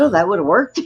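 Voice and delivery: loud sarcastic quip; mock agreement, said loudly. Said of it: "I said".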